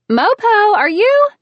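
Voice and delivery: shrill loud call, losing patience; yelling. A narrator's reading. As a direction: yell for him across the house. Heroine yelling for grandpa.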